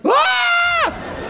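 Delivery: sudden high shrieked Ah; mock scream. Mimicking the movie's sudden scream, high shriek. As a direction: shriek like a startled victim. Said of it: "It's just like".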